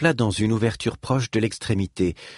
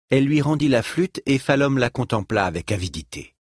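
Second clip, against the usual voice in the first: ordinary delivery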